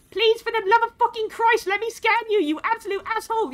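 high, loud frustrated outburst; exasperated yell. Real, not acted. Streamer swearing at the creature that won't be scanned, loud. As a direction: lose patience and swear at it.

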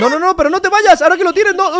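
high, loud begging; urgent shouted plea. Pretend. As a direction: cry out to keep someone from leaving. Pleading as Ansiedad leaves with Miedo.

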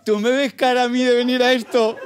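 mock reluctant girl. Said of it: "ha sido de".

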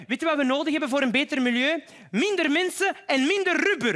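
high, emphatic slogan delivery; mock activist. Comedian quoting Anuna's climate advice, mock activist.

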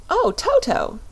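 high, delighted cry of surprise; surprised cry. Acted. Dorothy spots her dog Toto, high surprised cry. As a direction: squeal the dog's name in glad surprise. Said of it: "she cried".

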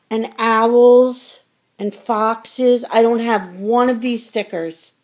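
gasp, then gush over the stickers; excited gasp. Real, not acted. Opening a friend's sticker package, excited and gushing.

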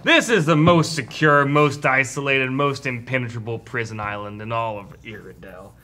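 drawling cowboy warden voice; cowboy accent. Acted. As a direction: speak like a Western cowboy. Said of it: "why do you sound like a cowboy".

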